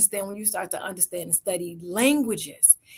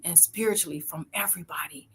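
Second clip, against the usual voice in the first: whispered; 4 semitones lower, 6 dB quieter than usual. Real, not acted. Unvoiced trailing end.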